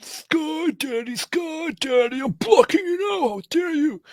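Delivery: high, drawn-out mock shriek; hammed-up indignant squeal; mock outrage. Streamer parodying atheist refusing prayers, mock outrage.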